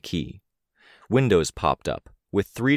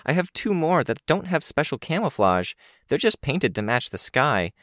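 put-on quick, high eager girl; young girl voice; 8 semitones higher than usual. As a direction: rattle it off like an excited kid. Kenzie's voice held.

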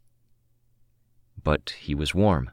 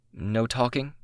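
tentative venture; 7 semitones higher than usual. A narrator's reading. Narrator ventures a rule to the partner, tentative. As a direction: venture it as a question, pitched higher. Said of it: "I ventured".

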